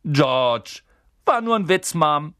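put-on loud, high boyish protest; joking twin. Twin telling his mother it was just a joke, loud and high. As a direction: shrug the joke off to mom.

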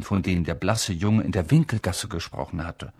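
ordinary delivery, nothing marked

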